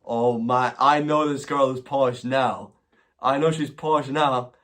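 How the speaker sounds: ordinary talk, nothing marked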